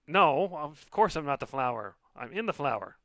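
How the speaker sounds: high, loud tiny voice; indignant tiny voice. Acted. Lily spirit voice.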